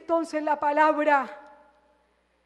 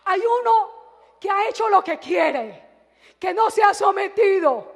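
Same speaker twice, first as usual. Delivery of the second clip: louder, higher accusing repeat of "Hay uno"; 4 semitones higher, 6 dB louder than usual. Retold or imitated. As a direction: press the charge on "uno" with a raised voice. (acusación en voz alta)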